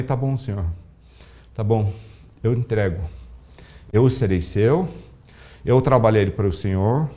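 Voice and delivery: hushed surrender to the Lord; prayer of surrender. Retold or imitated.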